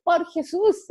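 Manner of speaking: high, loud exclamation; daughter's exclamation. Retold or imitated. Mother repeating her daughter's catchphrase, high and loud. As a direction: fling it out like an exasperated girl. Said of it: "ella dice".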